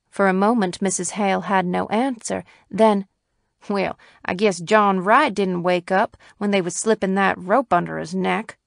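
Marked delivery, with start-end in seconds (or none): none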